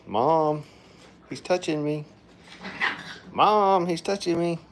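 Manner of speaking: put-on high, loud whiny kid voice; whiny tattling little sibling. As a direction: tattle to Mom like a bratty kid. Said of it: "that one's like".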